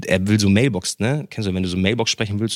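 ordinary talk, nothing marked

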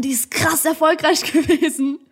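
laughing through sarcastic words. Real, not acted. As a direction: laugh at the tiny follower count. Sarcastic about six subscribers.

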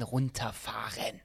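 leise und langsam geflüstert. Real, not acted. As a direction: das Wort ganz langsam wegflüstern. Appell, sich zurückzuhalten. (langsam geflüstert, als Beispiel fürs Runterfahren)

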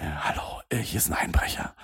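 hushed whisper into the phone. Retold or imitated. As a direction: whisper it so nobody hears. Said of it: "dass sie angerufen hat und dann so meinte".

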